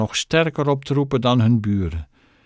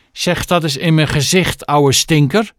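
high, loud, threatening dare; angry challenge; 6 semitones higher, 5 dB louder than usual. A narrator's reading. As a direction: dare him to say it to her face. Bully squares up to old man. (uitdagend en boos, met hoge stem)

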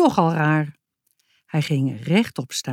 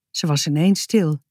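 usual speaking voice

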